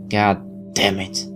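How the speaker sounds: normal talking voice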